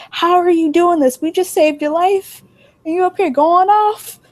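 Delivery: high, retold indignant scolding. Friends who saved her life scold Beautiful, indignant.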